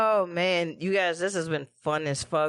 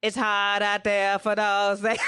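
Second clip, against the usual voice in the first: put-on loose, laughing Boston accent; mock JFK Boston accent; 3 semitones higher than usual. Joking about JFK's accent, hammed-up Boston voice.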